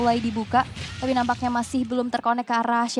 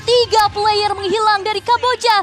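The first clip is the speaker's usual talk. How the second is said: loud, high play-by-play call; excited commentary; 6 semitones higher, 5 dB louder than usual. Real, not acted. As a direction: hype up the team fight. Play-by-play of a team fight.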